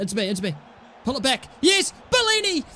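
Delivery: ordinary talk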